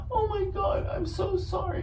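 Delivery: high, whimpering fake apology; mock weepy sorry; mock crying. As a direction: sob it in hammed-up falsetto. Mock wife voice.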